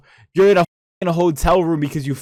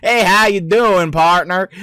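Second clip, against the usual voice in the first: higher-pitched cowboy accent; cowboy-style accent; 4 semitones higher than usual. Shown as a demonstration.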